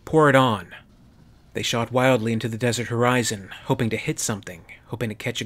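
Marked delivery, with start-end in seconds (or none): 0.1-0.7 loud barked command; captain commanding his squad. A narrator's reading. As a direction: order the fire, full voice. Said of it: "commanded Glass".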